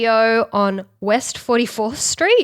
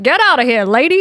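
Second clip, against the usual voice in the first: louder, higher imitated dismissal; mock indignant; 4 semitones higher, 5 dB louder, faster than usual. Singer telling the woman to leave, mock indignant. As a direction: imitate someone shooing a stranger away. Retelling man ordering her out.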